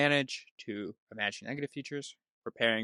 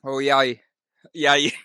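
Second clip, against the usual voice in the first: ordinary delivery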